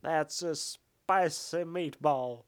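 very slow, heavily hammed Cage delivery; Nick Cage overacting. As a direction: drag it out like an overacting star. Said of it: "a Nick Cage overacting voice".

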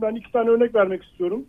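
ordinary talk

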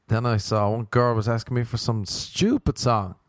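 ordinary talk, nothing marked

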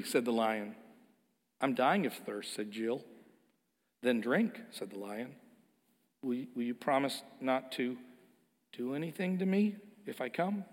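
put-on hushed reading of a children's tale; story reading. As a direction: read the lion scene gently. Reads C S Lewis scene.

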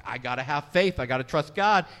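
retelling the widow giving in, loud mock earnestness; widow convincing herself. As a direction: talk yourself into it out loud. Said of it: "she was like".

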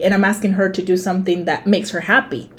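normal talking voice

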